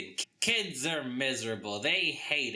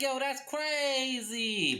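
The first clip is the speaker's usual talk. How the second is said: mock amazed; 12 semitones higher, slower than usual. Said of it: "she's like".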